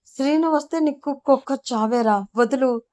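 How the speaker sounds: narrator's understated shouted demand; mildly acted shout. A narrator's reading. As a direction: act out a yell without full force. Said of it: "గట్టిగా అరుస్తూ ఉంటుంది".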